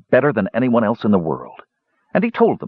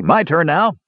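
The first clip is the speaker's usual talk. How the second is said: lightly voiced dwarf raising a mug, higher; a hearty toast, raised higher; a hint of a dwarf host toasting the mage; dwarf's toast; 8 semitones higher than usual.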